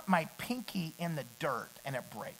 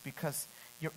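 ordinary delivery

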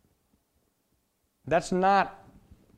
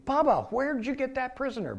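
surprised questioning; 11 semitones higher, 4 dB louder than usual. Retold or imitated. Buddies question Bubba in the story.

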